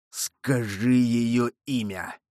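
slow, insistent demand; mild cry. A narrator's reading. Monk demands a name from the dying man, slow mild cry. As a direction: press for it word by word, without real shouting. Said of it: "крикнул монах".

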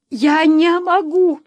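high, loud, drawn-out protest; desperate refusal. A narrator's reading. Wife refusing to leave without her daughter, desperate. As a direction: cry it out slowly, in anguish.

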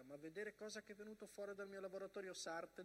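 usual speaking voice

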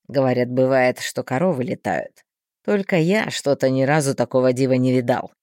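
low, mocking scheming man; sly man voice. A narrator's reading. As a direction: scoff at her in a low voice. Thin man mocks her.

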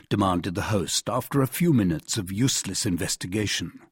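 plain reading voice

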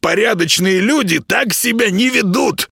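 high-pitched, scolding creature voice; grumpy little house spirit. Acted. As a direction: nag like an indignant tiny housekeeper.

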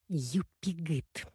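very soft, slow murmur; quiet secret word. A narrator's reading. Sister to brother at the funeral, quietly. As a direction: say it hushed and drawn out. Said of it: "тихо сказала мне Соня".

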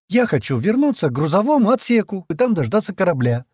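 high, loud, determined; firm insistence. A narrator's reading. Джемма demanding to go back to the ship, firmly. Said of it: "твёрдо проговорила она".

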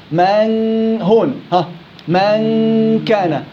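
من كان with the nasal n held long; slow nasal drill; long ghunna. Shown as a demonstration. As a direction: stretch the hum slowly. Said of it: "عندي غنة".

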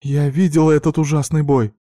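shouting. A narrator's reading. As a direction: yell it into the phone. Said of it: "крикнула она в трубку".

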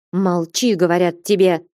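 usual speaking voice

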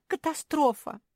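high, loud cry of horror; squeal. A narrator's reading. Naretta horrified by insects, squealing. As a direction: squeal it as a disaster. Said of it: "взвизгнула Наретта".

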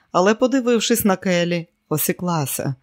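usual speaking voice

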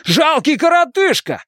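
very high-pitched, outraged cry; indignant high yell; angry yell. A narrator's reading. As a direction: hurl it as a furious insult. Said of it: "заорал он на Хейлса".

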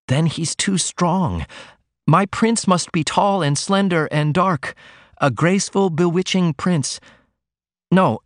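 put-on shy young daughter pouring out her worries; young woman Ruth. A narrator's reading. Ruth confides in her mother.

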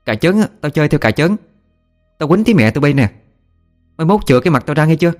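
mock-furious tongue-lashing of the ghosts; cursing scold. Mother whipping the ghosts while cursing, scolding tirade. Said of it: "vừa đánh vừa chửi".

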